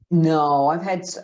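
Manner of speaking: normal talking voice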